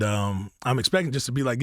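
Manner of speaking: usual speaking voice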